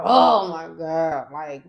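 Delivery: loud, slow oh my God; exasperated complaint. Retold or imitated. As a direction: drag it out, fed up. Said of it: "she was like".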